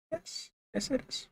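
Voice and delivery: soft, breathy reply to himself; quiet self-answer. Real, not acted. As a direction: answer yourself under your breath. Answers own question.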